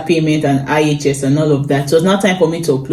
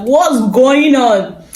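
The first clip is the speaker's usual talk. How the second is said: baffled; 6 semitones higher, much slower than usual. Retold or imitated. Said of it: "I'm like".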